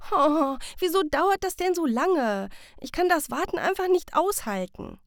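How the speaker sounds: put-on high, loud, fidgety child whining; impatient child. A narrator's reading. Sam hopping from foot to foot in the workshop, impatiently whining loud and high. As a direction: whine like a kid who can't wait. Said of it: "tippelt ... und schnauft".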